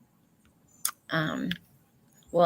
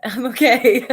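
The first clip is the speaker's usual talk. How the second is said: high, loud reassurance breaking into laughter; giggling through tears; teary laugh; 5 semitones higher, 5 dB louder than usual. Real, not acted. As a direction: laugh it off with wet eyes. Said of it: "My eyes just got a little wet".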